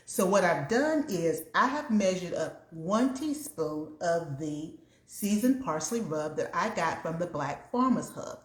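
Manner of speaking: usual speaking voice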